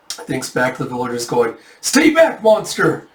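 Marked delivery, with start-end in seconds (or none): 1.8-3.1 high, loud villager's cry; acted panic, pitch leaping up; terrified shout. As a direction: yell to ward off a monster. Villagers drive off a monster.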